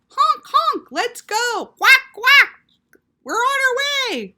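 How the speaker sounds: very high, loud goose and duck voices; loud honking, quacking birds; honking geese. Acted. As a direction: honk and quack to gather the flock. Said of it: "honk geese and quacking ducks make loud gathering calls".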